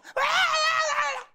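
very high, very loud mock child shriek; imitated kid's scream. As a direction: screech like a bawling toddler. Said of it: "the kid goes".

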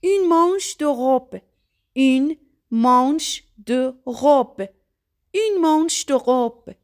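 slow, careful French "Une manche de robe"; French pronunciation. Shown as a demonstration. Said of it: "یعنی آستین پیراهن".

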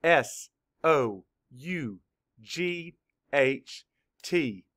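S O U G H T spelled out letter by letter, slowly; teacher spelling S O U G H T for children. Shown as a demonstration. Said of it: "Spell it".

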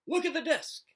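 very high, loud alarmed shout; a girl shouting urgently about the desk. A narrator's reading. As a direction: yell it, pointing in alarm. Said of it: "Tab's voice scaled an urgent octave".

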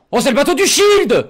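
high, loud cry of joy; happy shouting. Real, not acted. Fan spotting the Shield's boat, happy shouting. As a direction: shout it out, overjoyed. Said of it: "Excusez-moi si je crie".

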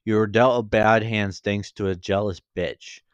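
ordinary delivery, nothing marked

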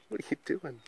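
soft, hushed what are you doing?; quiet question. Real, not acted. Owner quietly asking her guinea pig, hushed question. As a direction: ask it gently, under your breath.

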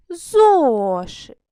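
Зошит with a loud, drawn-out ЗО. Shown as a demonstration. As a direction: stretch and punch the first syllable of Зошит. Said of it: "ми вимовляємо гучніше".

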